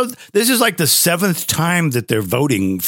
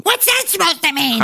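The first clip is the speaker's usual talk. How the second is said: put-on girlfriend voice; 13 semitones higher than usual.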